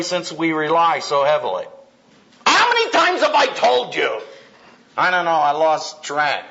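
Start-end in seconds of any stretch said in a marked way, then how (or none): 2.5-4.2 higher, exasperated nag; mock nagging wife; mock nagging spouse. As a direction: play the fed-up spouse, pitched up.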